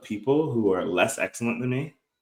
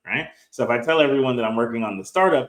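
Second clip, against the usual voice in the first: ordinary delivery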